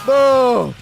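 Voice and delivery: high shouted no; loud no. Real, not acted. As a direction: cry out in dismay. Dismay at missing the moment.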